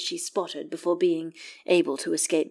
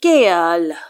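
a sharp, louder warning of the name; protesting; 9 dB louder than usual. A narrator's reading. Will scolding Gale for his bluntness, protesting. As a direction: cut him off in protest. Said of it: "Will protests".

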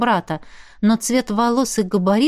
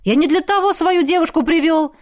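indignant, raised and higher; angry protest; 8 semitones higher, 3 dB louder than usual. Acted. Jet furious with Molly over his girlfriend, heated protest. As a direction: snap back at her.

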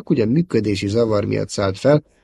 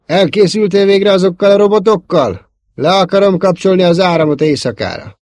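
raised, high-pitched calling from below; shouting; 10 semitones higher, 8 dB louder than usual. A narrator's reading. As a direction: yell it up the stairs, impatient to be answered. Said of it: "Kiáltás harsant odalentről". (lentről felkiabálva)

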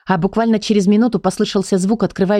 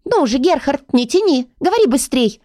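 high-pitched, impatient little book; impatient urging; 7 semitones higher than usual. A narrator's reading. Leonila hurries Gerhard to speak, impatient. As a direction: hurry him along in a high voice. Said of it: "поторопила Леонила".

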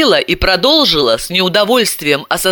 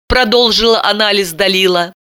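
plain reading voice

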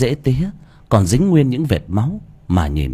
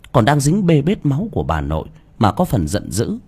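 plain reading voice

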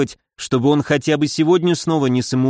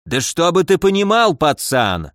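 ordinary delivery

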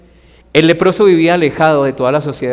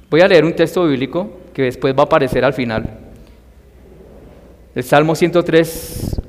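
ordinary delivery

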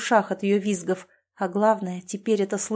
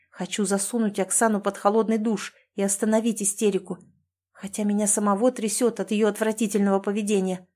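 ordinary delivery, nothing marked

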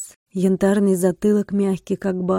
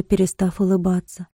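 plain reading voice

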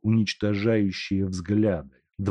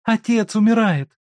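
desperate cry; 7 dB louder than usual. Acted. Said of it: "закричала Ганя".

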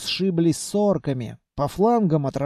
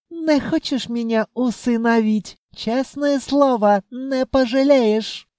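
wheedling djinn; 7 semitones higher than usual. A narrator's reading. Djinn asks the owner to adopt him, coaxing.

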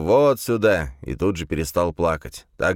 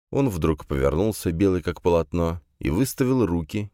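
ordinary delivery

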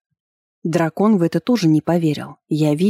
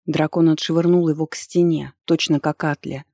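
usual speaking voice

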